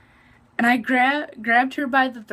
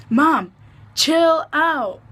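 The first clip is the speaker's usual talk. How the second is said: louder and higher, hushing mom; calling out; 7 semitones higher, 7 dB louder, much slower than usual. Retold or imitated. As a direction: loudly tell mom to calm down. Telling her mom to calm down.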